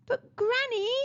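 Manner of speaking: put-on high-pitched child's protest; protesting little boy. A narrator's reading. Boy interrupting his scolding granny, high protest. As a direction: chip in with a high boyish plea. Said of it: "Chiku chipped in".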